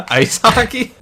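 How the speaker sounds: laughing. Pretend. Canadian joke, laughing high.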